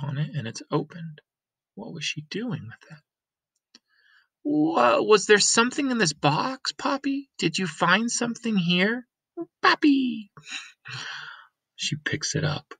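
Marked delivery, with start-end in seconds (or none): 4.4-10.3 put-on much higher girl's voice; bright young girl calling out; girl Lena. Lena coming home to her Granny, higher girl's voice.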